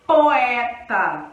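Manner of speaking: poeta with o and e each full and loud; both vowels strong. Shown as a demonstration. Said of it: "Veja que as duas vogais, elas são fortes".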